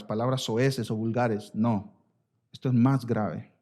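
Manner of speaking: usual speaking voice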